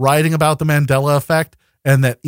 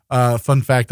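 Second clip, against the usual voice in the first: normal talking voice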